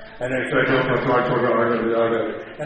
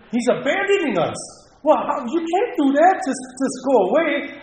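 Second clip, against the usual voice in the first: higher and louder, upset pleading; panicked protest; 8 semitones higher, 4 dB louder than usual. Retold or imitated. Inner thought as the counselor walks out, raised and panicked. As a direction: blurt it out as if being abandoned. Counselor walking out with his box.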